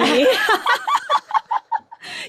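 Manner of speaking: very high, loud laugh falling away; shrieking laugh. Real, not acted. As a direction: let a shrieking laugh roll down. Delighted at her own old joke.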